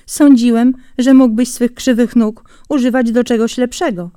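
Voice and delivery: mock his crooked legs; sneering hare. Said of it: "zaśmiał się drwiąco zając". (zając drwi z Jeża, głośno i wyniośle)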